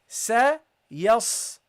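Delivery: Sa said light, yas with heavy sad; light then heavy. Shown as a demonstration. Teacher showing light versus heavy letters to students. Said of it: "the first one is light and the second one is heavy".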